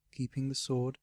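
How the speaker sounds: plain reading voice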